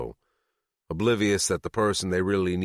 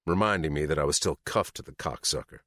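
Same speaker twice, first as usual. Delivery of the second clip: plain reading voice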